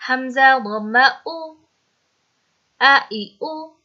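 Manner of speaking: measured spelling chant with the final vowel held level; repeat-after-me drill. Shown as a demonstration. Teacher leading pupils to repeat hamza with vowels, chanted drill. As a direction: call out letter, vowel sign, then sound for repetition. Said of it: "मेरे साथ repeat करो".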